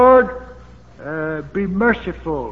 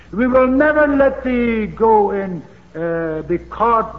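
louder, slower protesting vow; mock protest; 5 semitones higher, 7 dB louder, slower than usual. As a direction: insist loudly it will never happen. Peter's reassurance retold, louder.

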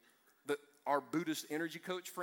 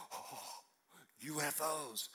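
hushed imitation of an awestruck believer; low, breath-held mock wonder; mock awe; 3 semitones lower, 8 dB quieter, much slower than usual. Preacher mimicking congregants who see a light from heaven, mock-awed. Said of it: "some of you are like".

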